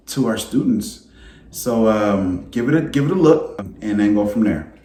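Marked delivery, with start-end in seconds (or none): none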